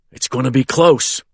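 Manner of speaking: usual speaking voice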